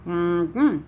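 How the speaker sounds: two hummed syllables; closed-mouth hum. Shown as a demonstration. Teacher demonstrates the humming one for wagon, two-beat hum. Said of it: "say the word with your mouth closed".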